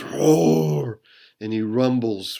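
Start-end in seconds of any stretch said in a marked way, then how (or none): 0.0-1.0 playful bear roar. Acted. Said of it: "Bear roars".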